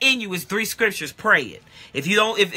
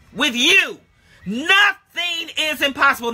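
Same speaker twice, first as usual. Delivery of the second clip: higher, louder, driving sermon; full-force preaching; 5 semitones higher, 3 dB louder than usual. Acted. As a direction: preach it hard to the church.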